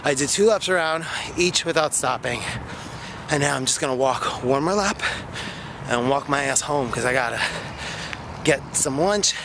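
ordinary delivery

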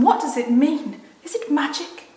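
acted girl's bewildered outcry; distressed cry. A narrator's reading. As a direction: plead for an answer. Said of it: "cried Susan".

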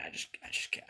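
ordinary delivery

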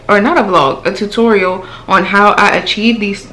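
normal talking voice

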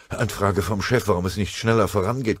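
ordinary talk, nothing marked

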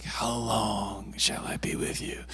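slow, soft, whispered mock lament; mock-exasperated whisper. Joking at friends who do something silly, exasperated whisper. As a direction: whisper it, dragging out each word.

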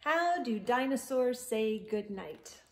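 usual speaking voice

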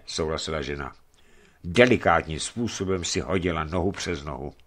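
plain reading voice

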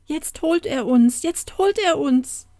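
high, frightened wail without full screaming; acted fright, pitched well up; terrified cry. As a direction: cry out in panic, rising on the repeat. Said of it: "schrie die Kätnersfrau, die vor Schrecken".

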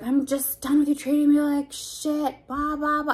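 loud, high-pitched imitation of an angry partner; mock angry rant. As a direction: mimic his outburst, drawn out and loud. Retelling partner's angry outburst.

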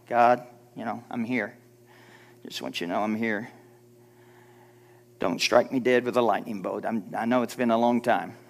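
low, soft, sheepish mumbled prayer; hesitant and hushed, apologetic to God; timid prayer. Acted. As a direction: pray small, afraid to take up room. Acting out meek, timid prayer.

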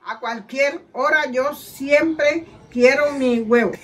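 deliberate, drawn-out ad line; slow reading. Shown as a demonstration. Mother finishing the egg slogan, slow reading. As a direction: read slowly with space between words. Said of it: "léelo lento y mirando la cámara".

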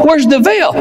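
ordinary talk

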